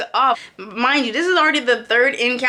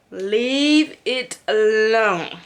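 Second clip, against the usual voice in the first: slow resigned; much slower than usual. Retold or imitated. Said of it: "I'm like".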